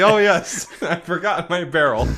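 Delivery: breaking into real laughter mid-line; high and giggling through the words; laughing. Real, not acted. Player answers about the forgotten barrel while laughing.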